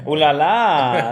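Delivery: put-on French accent.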